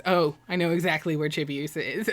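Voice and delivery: quiet knowing. Retold or imitated. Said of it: "Ray says".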